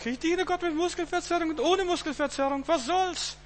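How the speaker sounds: jokey squeaky high voice, far above his own; mock high voice. Preacher joking to the congregation about aches in a mock high voice. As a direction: toss it off in a silly high pitch.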